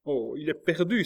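high, loud cry of dread; alarmed cry. A narrator's reading. Marguerite cries out in despair at the closed door. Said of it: "s'écria Marguerite".

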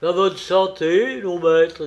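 loud, hearty toast; toast sonore. Acted. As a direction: raise a glass and call out the toast. Jean trinque avec son hôte. (santé lancée haut et fort)

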